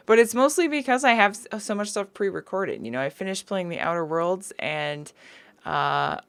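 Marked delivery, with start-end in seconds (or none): none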